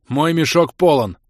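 loud blunt report; half-orc reporting full sack, loud; brief report. Acted. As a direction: report it short and hard. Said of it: "коротко доложил Бом".

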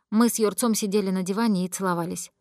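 ordinary delivery

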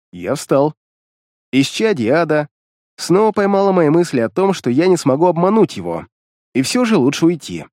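plain reading voice

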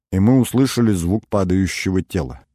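ordinary delivery

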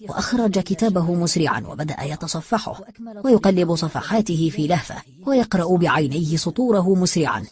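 ordinary delivery, nothing marked

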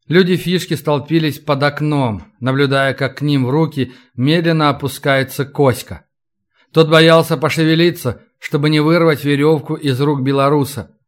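usual speaking voice

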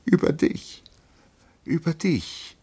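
high, tearful acted wail; weeping. A narrator's reading. Lieutenant weeps over his friend, high and tearful. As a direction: cry it out in a high voice. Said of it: "bitterlich zu weinen".

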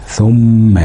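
ثم stretched on a nasal م; held ghunna. Shown as a demonstration. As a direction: hold the م of ثم as a hum. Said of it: "أو على الميم المشددة". (ثم باتكاء طويل على الميم)

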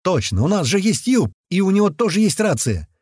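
high-pitched sudden realisation; excited yell. A narrator's reading. Fighter remembering their ally has a radio, yelling. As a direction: call it out as it dawns on you. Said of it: "кто это орёт".

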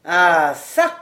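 ordinary delivery, nothing marked

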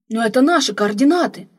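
loud startled outburst; exclaiming. Acted. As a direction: shout it out in shock. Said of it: "воскликнул один из них". (изумлённый громкий возглас)

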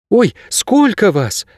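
put-on high, drawn-out girl's surprise; startled young heroine, pitched high; surprised. A narrator's reading. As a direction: exclaim slowly in a lifted girl's voice. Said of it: "удивлённо сказала Кейса".

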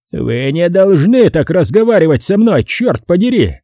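high, loud indignant howl; furious howl. A narrator's reading. Charlie howling at Rick for his insult, furious. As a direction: howl at him in outrage. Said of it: "Яростно взвыл он".

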